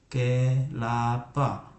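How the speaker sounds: put-on kelapa read slowly, syllable by syllable, as a child joins them; slow blended syllables. Shown as a demonstration. Parent demonstrating how a child first sounds out kelapa, slowly. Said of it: "Orang tua menyempurnakan pelafalannya".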